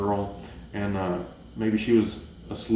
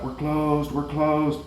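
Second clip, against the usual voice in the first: higher voice calling across the hall; 6 semitones higher, much slower than usual. Retold or imitated. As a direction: sing out a closing warning to the last customer. Janitor calling out, food court.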